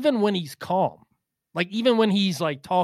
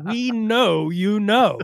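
drawn-out, higher mock accusation; mock interrogator; 6 semitones higher, 4 dB louder, much slower than usual. Cohost grilling Vin on where Gurry is, mock interrogation. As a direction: stretch it out like a hammed-up interrogator.

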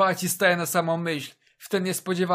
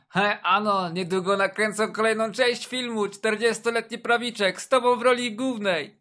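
teasing mates; 5 semitones higher, 3 dB louder than usual. Retold or imitated. Colleagues mocking him.

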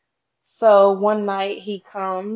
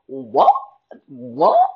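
high, louder incredulous "What?", rising each time; stunned disbelief; 9 semitones higher, 6 dB louder than usual. Retold or imitated. Retelling the stepdad's comment, stunned high rising "What?". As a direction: replay the moment you couldn't believe your ears. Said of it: "And I'm like".

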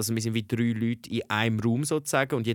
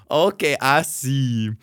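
slow, louder imitated reply; knowing acknowledgement; 5 dB louder, much slower than usual. Girlfriend finally understanding who that was, knowing acknowledgement. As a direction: draw out the mock understanding.

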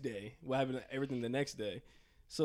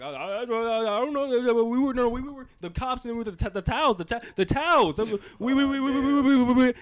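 loud, higher, stammering in a panic; panicked stutter; 9 semitones higher, 11 dB louder than usual. Retold or imitated. Trying to explain the arrest to the parents, loud panicked stammer. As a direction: trip over every word, rising in a panic. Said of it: "I remember I was stuttering".

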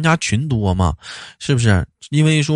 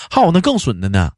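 ordinary delivery

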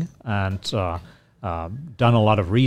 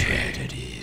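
whispered; 13 semitones lower, 7 dB quieter than usual. Pretend. Whispered echo of the word.

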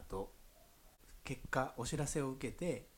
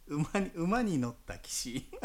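amused; 7 semitones higher, 5 dB louder than usual. Real, not acted. Amused at drawing horse card.

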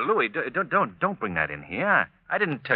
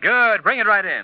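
louder, higher call to bring it in; 10 semitones higher, 8 dB louder than usual. Acted. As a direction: shout the order across the room. Calling to Louis to bring packages.

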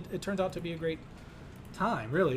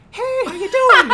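high, loud, gushing fake greeting; fake friendliness; 14 dB louder than usual. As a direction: put on a squealy phony hello, laughing. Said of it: "it makes you fake".

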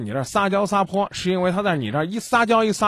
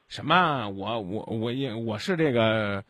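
mock stammering excuses; 6 semitones lower, 4 dB quieter, slower than usual. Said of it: "他说：张明，你别听他们乱讲".

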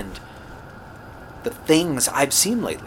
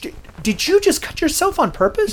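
shocked disbelief; 3 dB louder than usual. Acted. He sees her cut herself.

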